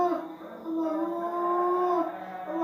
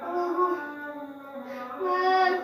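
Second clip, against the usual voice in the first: moaning in pain; much faster than usual. Real, not acted.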